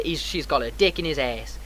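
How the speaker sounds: ordinary talk, nothing marked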